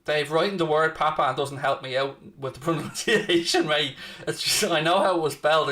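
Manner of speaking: normal talking voice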